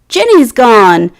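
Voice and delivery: ordinary delivery, nothing marked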